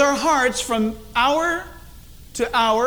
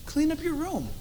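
soft, higher, gentle ask; unthreatening request; 6 semitones higher, 7 dB quieter than usual. Shown as a demonstration. Parent asking a child to tidy up, unthreatening. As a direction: keep it small and soft, no threat. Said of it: "That doesn't sound very threatening".

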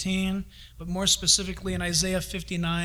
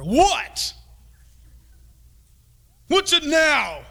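loud, higher annoyed what; a parent who's fed up; mock exasperated bark; irritated snap; 6 semitones higher, 5 dB louder, much slower than usual.

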